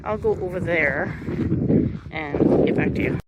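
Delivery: muffled by a hand over the mic. Real, not acted. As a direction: talk through a hand covering the mic. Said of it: "I'm trying to cover the microphone with my hand".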